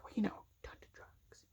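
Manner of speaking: imitated hushed, voiceless aside; whisper. Vice principal hinting at drugs to student, whispered. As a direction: whisper it like a delicate secret. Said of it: "why are we whispering?".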